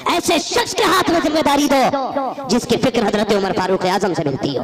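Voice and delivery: loud, slow sermon voice; slow, loud pulpit oratory; fiery preaching. Acted. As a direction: preach it out to the crowd. Preaching at full force.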